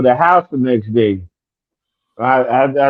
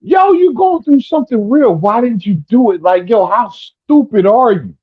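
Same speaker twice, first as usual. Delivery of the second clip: higher-pitched imitation of an exasperated dad; mimicked fatherly disbelief, pitched up; scolding father; 6 semitones higher than usual. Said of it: "my father was like".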